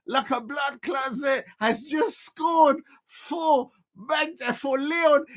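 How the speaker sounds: high, laughing, shouty repeats; laughing disbelief. Real, not acted. Fan reacting to a shock equaliser, laughing in disbelief.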